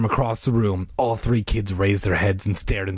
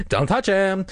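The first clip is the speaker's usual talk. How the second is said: high alarmed cry, held short of full volume; acted scream; 10 semitones higher than usual. A narrator's reading. Diane warning Margaret off the boy, screaming. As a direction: call out a high-pitched warning. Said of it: "Diane screamed".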